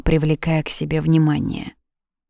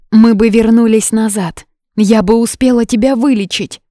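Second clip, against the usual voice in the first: raised, louder, eager pleading; desperate hope; 5 semitones higher, 6 dB louder than usual. A narrator's reading. Юна hopes to turn back time, eager and urgent. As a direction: seize on the idea urgently.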